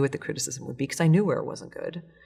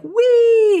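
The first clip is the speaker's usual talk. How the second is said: much higher, louder joyous squeal; gleeful whoop; 13 dB louder than usual. Pretend. Inspiration driving off the cliff, gleeful high squeal. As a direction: shriek with delight going over the edge. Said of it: "inspiration's like".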